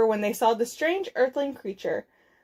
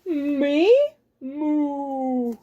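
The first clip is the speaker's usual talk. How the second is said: questioning word, then long moo; mooing cow voice. Acted. Cow learns Zork will ride her, mooing.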